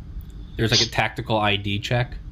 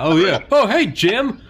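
higher, louder hammed-up; mock flattered greeting; 9 semitones higher, 5 dB louder, slower than usual. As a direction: greet like a charmed older customer.

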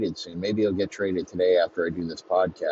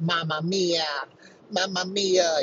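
high, sing-out Italian exclamation; mock Italian accent; 10 semitones higher than usual. Woman naming her favorite movie, high mock Italian accent. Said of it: "she turns Italian all of a sudden".

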